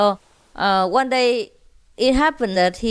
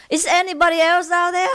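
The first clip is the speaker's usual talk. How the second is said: mock call out; 8 semitones higher, faster than usual. Punchline, man calls out.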